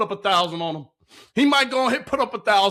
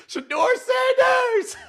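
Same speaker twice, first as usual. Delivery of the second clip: stretched-out, much higher hype yell; excited shout; much slower than usual. Real, not acted. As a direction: yell his name like the stadium just erupted. Hyped over a great throw.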